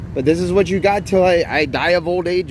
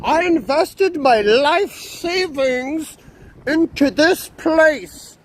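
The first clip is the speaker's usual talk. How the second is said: higher, slow, hammed-up whiny scolding; mock the angry mansion owner, drawn out and pitched up; imitating Ice scolding; 8 semitones higher, slower than usual. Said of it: "He was talking to me really bad".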